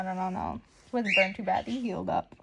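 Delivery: ordinary delivery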